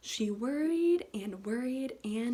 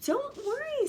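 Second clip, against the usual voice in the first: much higher soothing mum; mother's voice; 12 semitones higher than usual. A narrator's reading. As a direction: reassure like a loving mum. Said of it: "said her mother".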